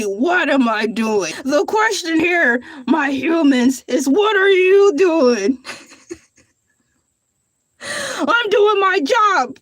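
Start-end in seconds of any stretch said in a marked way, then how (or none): 0.0-1.3 quoting the chat. Retold or imitated. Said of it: "asked me". 2.9-9.6 hammed-up offended retort; playful huffy protest; mock indignation.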